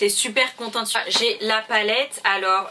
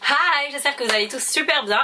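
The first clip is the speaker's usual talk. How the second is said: overexcited; 6 semitones higher, 3 dB louder, slower than usual. Real, not acted. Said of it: "je suis surexcitée".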